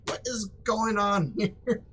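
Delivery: lightly voiced puzzled buddy, laughing; mock bafflement. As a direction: play a baffled friend, drawn out.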